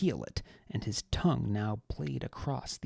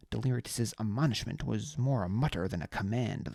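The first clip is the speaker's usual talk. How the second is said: plain reading voice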